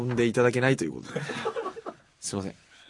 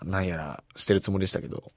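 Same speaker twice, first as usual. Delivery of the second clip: usual speaking voice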